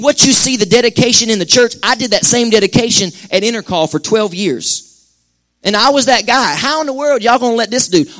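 high, loud preaching cry; preaching at full force. Acted. Pastor urging the congregation, preaching at full force. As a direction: lift the church with a ringing warning. Preacher recalling his old frustration.

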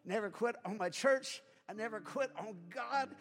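ordinary talk

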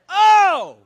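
very high, loud oh; practice shout. Shown as a demonstration. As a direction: shout from the belly not the throat. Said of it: "you don't do it in the throat".

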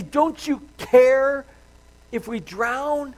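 high, loud, drawn-out cry; disciples' desperate plea. Acted. Disciples waking Jesus in the storm, crying out desperately. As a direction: plead loudly, stretching the key words.

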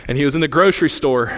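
usual speaking voice

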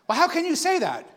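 questioning friend. Retold or imitated. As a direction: ask it like puzzled Sam. Friend Sam questions the preacher.

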